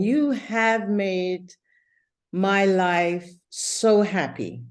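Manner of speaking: slow, careful example sentence; slow model sentence. Shown as a demonstration. Teacher modelling the example sentence for her student, slowly. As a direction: spell it out slowly for the learner. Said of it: "we would say".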